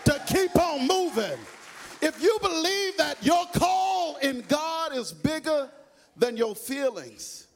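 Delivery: loud, high sung preacher's whoop; preaching at full force. Acted. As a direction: drive it home over the organ.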